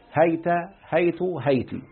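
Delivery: هيت، هيت، هيتي with open ha, silent ya, and the final ta voiced with u, then a, then i; demonstrating هيت with final ta as u, a and i; three vowelings. Shown as a demonstration. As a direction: recite the three endings of هيت one after another, each clearly marked. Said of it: "بفتح الهاء وسكون الياء وبعدها تاء مضمومة أو مفتوحة مكسورة".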